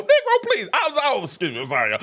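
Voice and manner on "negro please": laughing. Real, not acted.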